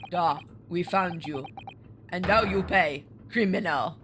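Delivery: accusing sailor with a put-on Russian accent. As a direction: attempt a heavy Russian accent. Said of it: "a Russian accent, but we'll try it".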